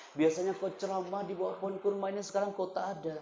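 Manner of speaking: high, impassioned lament; high-pitched sermon voicing the palm's lament; preaching. Preaching continues.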